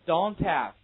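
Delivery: "Don't have" loud, t joined onward into have; linking through h. Shown as a demonstration. Teacher drilling h linking for students, loud linked reading. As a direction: model "Don't have" as one linked unit. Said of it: "这也是一样".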